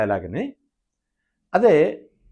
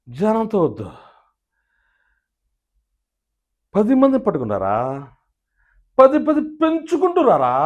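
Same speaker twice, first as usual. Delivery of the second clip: Krishnam Raju impression; 3 semitones higher than usual. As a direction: roar it like a rebel star. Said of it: "Krishnaraju గారు చెప్పాలనుకుంటే ఇదే dialogue".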